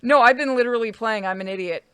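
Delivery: ordinary talk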